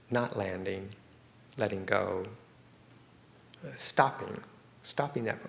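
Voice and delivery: unhurried meditation guidance with long silences; guided meditation. Real, not acted. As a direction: guide them gently into stillness, leaving space between phrases.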